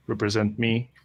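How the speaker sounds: usual speaking voice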